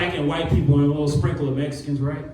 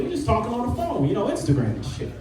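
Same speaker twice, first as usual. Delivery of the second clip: usual speaking voice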